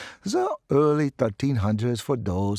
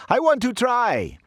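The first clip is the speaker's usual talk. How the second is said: high, loud eager cry; eager shout; 12 semitones higher, 5 dB louder than usual. Acted. Student calls out to the professor, eager and loud. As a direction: call out, desperate for a turn. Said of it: "More voices jumped in".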